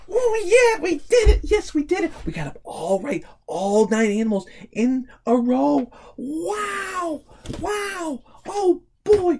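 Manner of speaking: over-the-top cheer for the finished row; excited cheering. Real, not acted. Puzzle completed.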